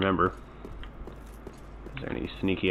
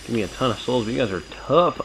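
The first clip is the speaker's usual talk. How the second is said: raging gamer; 3 semitones higher, 4 dB louder than usual. Real, not acted. Heated fight taunts, strain.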